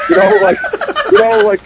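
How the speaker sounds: high, breaking into laughter; laughing. Real, not acted. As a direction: laugh through the words. Laughing at terrorist accusation.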